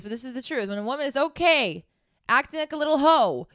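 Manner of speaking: normal talking voice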